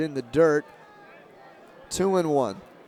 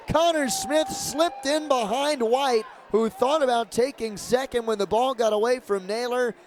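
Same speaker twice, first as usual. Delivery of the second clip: much higher, urgent play-by-play; excited pickoff call; 8 semitones higher than usual. Real, not acted. Announcer calling a surprise pickoff, excited and high. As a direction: voice jumps as the runner gets caught.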